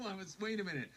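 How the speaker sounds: usual speaking voice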